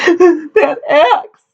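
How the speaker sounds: high tearful wail; sobbing. A narrator's reading. Daughter answers her suitor's question, sobbing. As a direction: squeal it through acted sobs. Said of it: "sobbed Amity".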